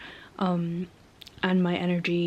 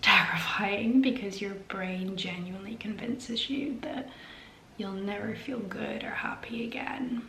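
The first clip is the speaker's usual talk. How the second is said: hushed, almost voiceless confession; whispering; 13 dB quieter than usual. Real, not acted. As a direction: whisper it close, heavy-hearted. Quiet confession about depression.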